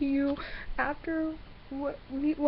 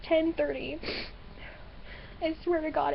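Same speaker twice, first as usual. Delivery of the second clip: ordinary talk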